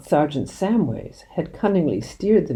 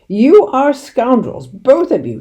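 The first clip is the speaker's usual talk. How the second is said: slow, high, loud furious shout; 8 semitones higher, 9 dB louder, slower than usual. A narrator's reading. Mister Hazel shouting insults at father and doctor, slow loud fury. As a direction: hurl the insult at them, slowly. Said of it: "shouted Mister Hazel".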